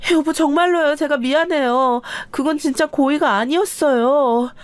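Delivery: cling and beg, voice pushed high; desperate pleading. A narrator's reading. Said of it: "바지가랑이를 잡고서 늘어지기 시작했습니다". (높은 목소리로 다급하게 매달리며 사과)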